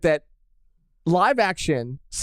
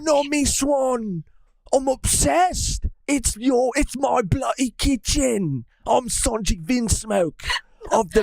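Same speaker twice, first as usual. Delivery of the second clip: slow, high mock British accent; hammed-up British lovestruck cook; British Sanji; 4 semitones higher, slower than usual. Sanji obsessed with Nami in his kitchen, hammed-up British accent. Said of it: "I feel like my British is not too bad".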